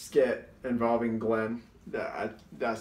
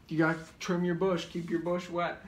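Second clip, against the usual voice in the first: higher, quick put-on voice; skit catchphrase; 7 semitones higher than usual. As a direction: rattle it off as a raised-voice catchphrase. Quoting the skit's gross catchphrase.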